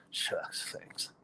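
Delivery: whispered, shy shucks; bashful whisper. Pretend. Cook answering customers' praise, bashful whisper. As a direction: hush it out like a modest cook. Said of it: "And I go".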